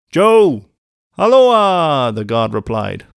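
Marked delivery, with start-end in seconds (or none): 0.1-0.7 high, loud hail; calling out. A narrator's reading. Coachman hailing the guard, calling out. 1.2-2.1 long, high, loud answering hail; calling back. A narrator's reading. Guard answering the coachman, calling back.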